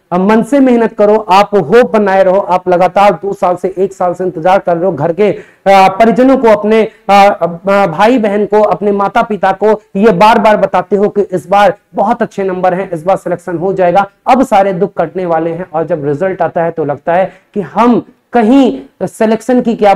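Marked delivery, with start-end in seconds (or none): none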